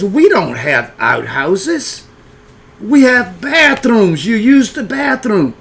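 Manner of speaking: slow, stretched-out imitated indignation; indignant correction. Hostess telling boy about bathrooms, indignant. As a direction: spell it out slowly as the upset hostess.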